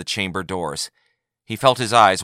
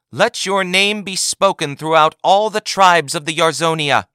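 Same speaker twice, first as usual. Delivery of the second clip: higher, louder ceremonial proclamation; intoned; 10 semitones higher, 6 dB louder than usual. A narrator's reading. Advocate General proclaims Yagar's name to the chamber, ceremonial. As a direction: proclaim it to the tribes. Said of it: "intoned the Advocate General".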